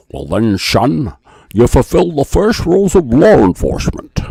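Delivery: put-on loud, slow Scottish-accented movie mentor voice; poor Sean Connery, booming the lesson; Sean Connery impression. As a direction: lay down the law like a gruff old Scottish cop. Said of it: "I'll do my, um, Sean Connery one".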